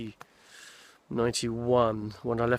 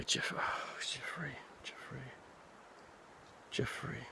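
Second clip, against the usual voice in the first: muttering to self; 14 dB quieter, slower than usual. Real, not acted. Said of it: "searching for a name".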